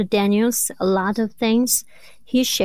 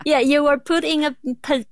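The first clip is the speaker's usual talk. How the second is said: laughing; 5 semitones higher, faster than usual. Real, not acted. Laughs while telling a story.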